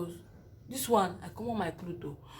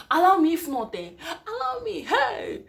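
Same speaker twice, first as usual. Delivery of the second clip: pleading laugh-cry; 8 semitones higher, 6 dB louder than usual. Retold or imitated. Begging nurses to let her go.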